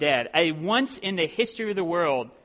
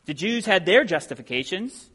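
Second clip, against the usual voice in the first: normal talking voice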